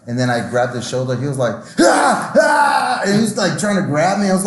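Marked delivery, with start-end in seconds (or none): 1.8-3.0 imitated high, loud yelps of fright; startled scream. Acting out a guy grabbed in his sleep, startled scream. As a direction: yelp like a sleeper jolted awake.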